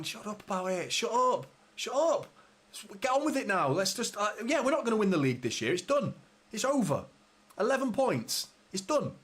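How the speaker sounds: a fan who has had enough, ranting; exasperated rant. Real, not acted. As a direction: snap at them to shut up and move on. Fed-up rant at fellow fans.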